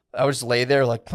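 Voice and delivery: ordinary delivery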